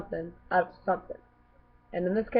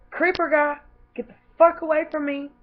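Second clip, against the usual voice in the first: loud, high yell at the creep; sharp yelled brush-off; retold yell; 9 semitones higher, 5 dB louder than usual. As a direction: shout him off in disgust. Yelling off a creepy stranger.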